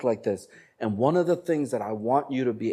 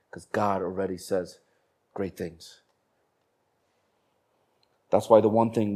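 ordinary talk

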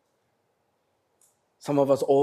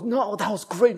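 higher mock compliment from the crowd; mock praise; 10 semitones higher than usual. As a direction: gush like the room does. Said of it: "everyone goes".

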